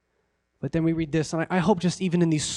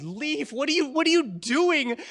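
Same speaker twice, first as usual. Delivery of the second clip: high, softly urgent plea; high-pitched, exasperated questioning; distressed Naomi; 9 semitones higher, 4 dB quieter than usual. Acted. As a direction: push her away in a high, pressing voice. Acting Naomi urging clinging Ruth away.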